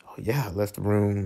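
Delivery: ordinary delivery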